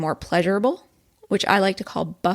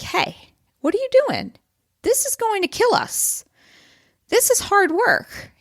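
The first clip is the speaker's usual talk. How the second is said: mock toddler yelling; 12 semitones higher than usual. Toddler brain objecting to exercise, high-pitched mock yelling. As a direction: play an alarmed little kid objecting. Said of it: "The little toddler starts yelling".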